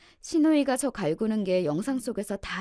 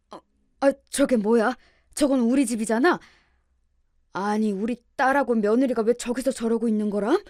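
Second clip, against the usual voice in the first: put-on bewildered older relative, louder than narration; flustered, raised-voice questioning; flustered father-in-law; 7 dB louder than usual. A narrator's reading. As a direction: blurt it out in loud, baffled disbelief. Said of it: "당황스러운 시아버지의 목소리".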